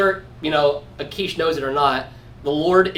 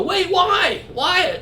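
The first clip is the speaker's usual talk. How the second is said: high, incredulous whine; mock dismayed protest; 14 semitones higher, much slower than usual. Someone let off a hated task, mock high-pitched dismay. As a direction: act out high-pitched disbelief.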